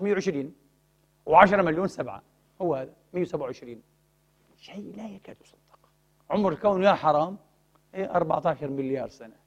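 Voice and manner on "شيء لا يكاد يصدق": awed whisper. Real, not acted. Awed aside about impossible odds.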